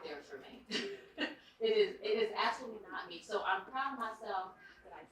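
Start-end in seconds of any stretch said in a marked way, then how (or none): 0.7-1.6 breathy laugh. Real, not acted. Laughs at being out there. 1.6-3.2 emphatic. Real, not acted. Laughing about stepping out.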